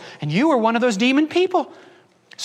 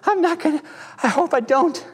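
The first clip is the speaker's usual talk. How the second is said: mock anxious sinner; 6 semitones higher than usual. Struggling sinner's voice mocked.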